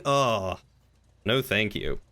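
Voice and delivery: ordinary talk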